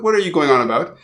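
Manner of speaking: ordinary talk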